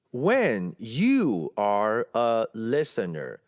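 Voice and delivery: slow, word-by-word "When you are a listener"; weight "are" and "a" as fully as "listener"; equal stress on every word. Shown as a demonstration. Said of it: "如果都是一样重呢". (每个词一样重，很慢地读)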